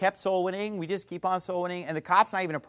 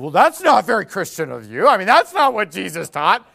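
a lightly put-on sneering critic, louder; mock atheist voice; 9 dB louder than usual. Preacher mocking atheists in a louder smug voice.

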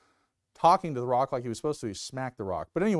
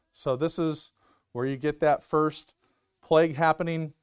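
ordinary talk, nothing marked

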